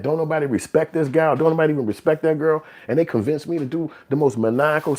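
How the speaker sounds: high-pitched, disbelieving self-questioning; incredulous realization. Acted. Acted realization of being tricked.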